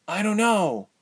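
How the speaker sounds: put-on high, puzzled young heroine. A narrator's reading. Ilse answers Tyke's question, high puzzled voice. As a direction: answer high-pitched and unsure. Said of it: "Ilse said, puzzled".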